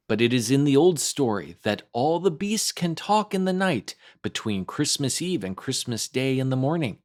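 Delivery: plain reading voice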